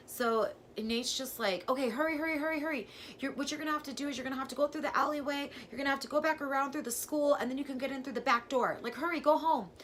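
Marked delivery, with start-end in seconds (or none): 1.7-9.7 high, loud and fast; urgent rush. Retold or imitated. Boyfriend on the phone urging her home, fast and urgent. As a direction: rattle off urgent orders.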